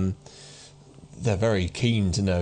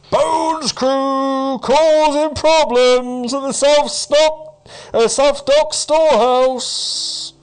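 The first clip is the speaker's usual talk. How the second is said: slow, high, booming public proclamation; town crier calling out; 8 dB louder, much slower than usual. Acted. Town crier broadcasting news through the town, calling out. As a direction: cry it loud, every word drawn out. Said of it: "the town crier sort of calling out".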